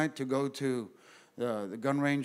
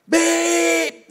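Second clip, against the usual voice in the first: high loud imitated car horn beep; mock horn honk; 9 dB louder than usual.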